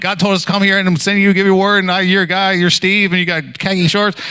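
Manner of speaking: high, rushed babble, imitated; mock-panicked run-on at a high pitch; nervous blurting. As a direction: blurt it all out in one panicky stream. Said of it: "blurted out this whole thing".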